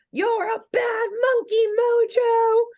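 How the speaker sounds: high scream. Retold or imitated. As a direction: shriek it like furious little girls. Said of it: "just scream".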